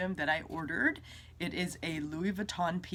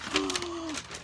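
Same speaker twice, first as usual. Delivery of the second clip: sharp high gasp; excited gasp; 11 semitones higher than usual. Real, not acted. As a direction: gasp in delight. Bag revealed.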